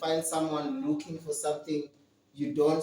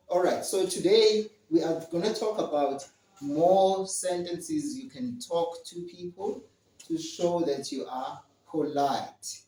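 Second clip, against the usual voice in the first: ordinary talk, nothing marked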